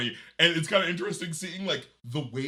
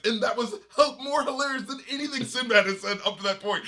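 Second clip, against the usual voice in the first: usual speaking voice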